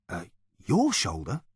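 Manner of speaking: ordinary delivery, nothing marked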